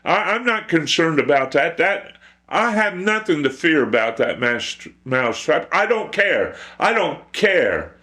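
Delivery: high, loud moo into dismissive cow talk. Acted. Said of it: "And the cow said".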